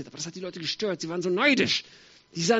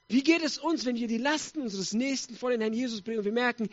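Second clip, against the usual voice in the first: ordinary talk, nothing marked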